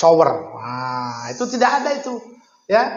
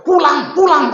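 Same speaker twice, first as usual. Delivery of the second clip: higher, louder "pulang, pulang"; mock chasing off; 8 semitones higher, 5 dB louder than usual. The kiai chasing an alumnus out of the yard, mock shout. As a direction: shoo them away, raising the voice. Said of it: "wuh, sudah diusir".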